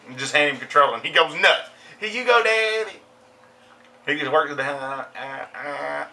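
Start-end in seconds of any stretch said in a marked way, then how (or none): 2.0-3.0 put-on high little-kid voice; imitating his son. Young son handing back the controller, imitated in a high voice. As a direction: pitch it up like a small child. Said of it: "Like my son".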